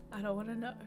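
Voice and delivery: ordinary delivery, nothing marked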